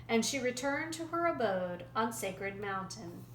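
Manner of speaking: plain reading voice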